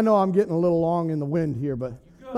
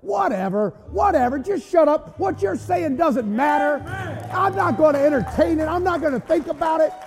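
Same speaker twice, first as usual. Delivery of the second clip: raised, resolute retort; defiant; 7 semitones higher than usual. Acted. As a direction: preach it as a firm brush-off to the devil. Defiant reply told to devil.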